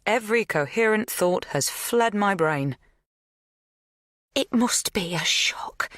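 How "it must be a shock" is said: consoling whisper. A narrator's reading. Magda softly consoling Kate.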